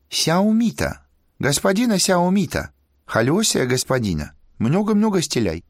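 high, sweet sing-song broken Russian; sing-song Chinese accent. Acted. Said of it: "сладкий певучий голос пропел".

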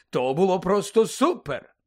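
loud, slow, punched compliment; gushing praise. Acted. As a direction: praise it with big enthusiasm. Bagman praises Harry's performance.

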